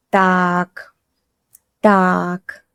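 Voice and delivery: low, soft musing Так; thoughtful tak. Shown as a demonstration. Teacher showing learners a pondering tak. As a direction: drop Так low and quiet, thinking it over. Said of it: "It can mean, «All right, let's think.»".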